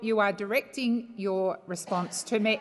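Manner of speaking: usual speaking voice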